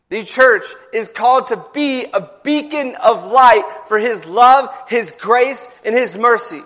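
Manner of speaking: preaching at full force. Acted. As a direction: preach it to the whole church, building.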